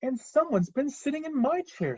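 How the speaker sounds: high mother bear, moderate volume; high-pitched, put-out Mama Bear; medium-sized Mama Bear. Acted. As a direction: lift the pitch without shouting. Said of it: "in her medium-sized voice".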